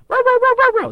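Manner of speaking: very high, loud yapping chihuahua; shrill chihuahua barks; tiny dog yapping. Retold or imitated. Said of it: "there are gonna be people".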